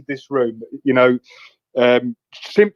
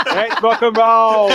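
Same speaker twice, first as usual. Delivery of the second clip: slow, high, laughing mock hype; stretched, giggly rock and roll; quoting Ashley; 7 semitones higher, 3 dB louder, much slower than usual. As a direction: drag it out like a hyped-up boss quote. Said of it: "he said things like".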